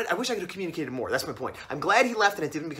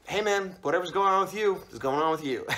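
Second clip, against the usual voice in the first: deadpan, unbothered brush-off; unemotional cool guy. Retold or imitated. Narrator brushing off the angry driver, coolly detached. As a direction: shrug it off without any emotion. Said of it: "I was just like".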